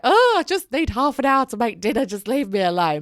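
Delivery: loud, exasperated plea to be left alone; frazzled plea. Retold or imitated. Mum cornered by wild kids at dinner time, loud exasperated plea. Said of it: "I just find myself being like".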